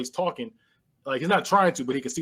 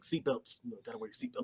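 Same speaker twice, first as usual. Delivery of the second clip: deadpan one-liner; 4 semitones lower, 15 dB quieter than usual. Retold or imitated. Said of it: "it was a terrible one-liner".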